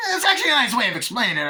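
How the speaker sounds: high, loud, rapid-fire laughing delivery; Tarantino. Shown as a demonstration. Film fan parodying the director, manic fast chatter. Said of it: "it's not like Tarantino".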